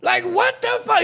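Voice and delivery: high-pitched exasperated outburst; retold exasperation. Streamer retelling the cop watching deliveries, exasperated. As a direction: replay your fed-up reaction, voice jumping way up. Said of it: "I'm like".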